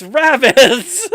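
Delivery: bursting into laughter on the word; laughing shout. Acted. Punchline shouted while laughing.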